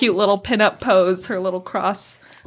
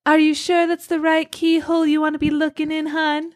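high coy pinup lady voice, higher; flirty painting; 6 semitones higher than usual. Acted. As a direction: tease the player like a sultry painted lady.

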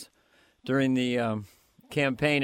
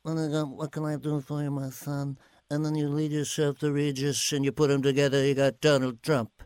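Regis Philbin style. Retold or imitated. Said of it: "that kind of New York rhythm".

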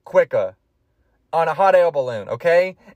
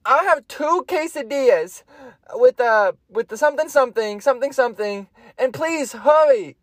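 Theodore voice; 6 semitones higher than usual. Retold or imitated. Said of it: "And he's like".

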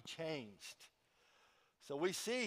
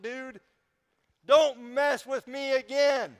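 higher, louder mock-angry rant; indignant lecture; 6 semitones higher, 8 dB louder than usual. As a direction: tell the king off, hammed up. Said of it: "That could have been his approach".

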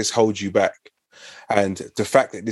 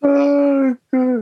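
mock dismay; 4 dB louder than usual. Real, not acted. Reacting to the auntie phone memory.

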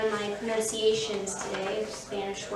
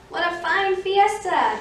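high, loud, savoured praise; delighted guests; 7 semitones higher, 5 dB louder, slower than usual. Acted. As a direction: gush it slowly as a happy guest. Happy guests leaving the party.

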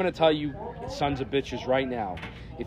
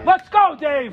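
loud high shout across the pool; calling from far away; 10 semitones higher, 7 dB louder, slower than usual. Real, not acted. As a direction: yell for him to come over.

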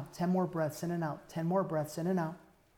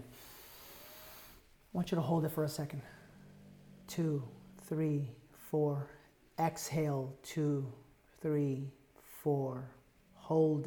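guided breathing; 3 dB quieter, much slower than usual. Acted.

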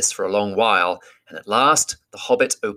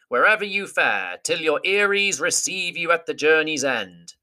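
higher ceremonial bird voice; formal eagle farewell; 8 semitones higher than usual. Acted. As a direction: intone it like noble eagles.

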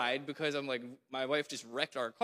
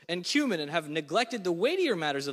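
raised, forceful reading of Jesus' rebuke; preaching scripture; 3 semitones higher than usual. Acted. Preacher reading Jesus' woes to the congregation, forceful. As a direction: proclaim each woe, leaning on it.

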